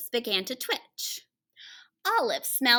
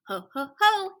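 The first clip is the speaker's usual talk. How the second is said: jolly Santa laugh; 7 semitones higher than usual. Acted. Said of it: "said Santa".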